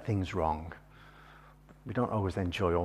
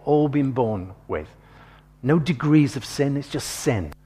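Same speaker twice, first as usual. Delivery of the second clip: louder, driving sermon voice; forceful preaching; 2 semitones higher, 6 dB louder than usual. Acted. Preacher urging the congregation that nothing fixes sin, forceful.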